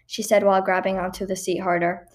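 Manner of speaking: ordinary delivery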